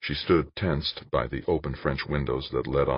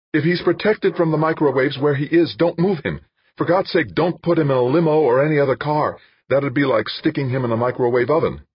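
high, loud pleading orders; urgent insistence; 11 semitones higher, 6 dB louder than usual. Acted. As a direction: press them hard not to move him. Urgent instructions over phone.

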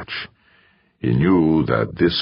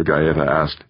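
ordinary delivery

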